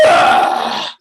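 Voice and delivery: deep annoyed grunt; low loud groan. Retold or imitated. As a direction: groan at the request. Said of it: "And I'm like".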